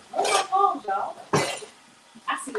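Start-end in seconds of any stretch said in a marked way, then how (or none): 0.1-1.2 mock upset. Real, not acted. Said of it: "I'm in my feelings".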